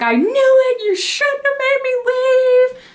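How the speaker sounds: hammed-up angry protest; mad. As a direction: wail the accusation indignantly. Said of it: "I was so mad".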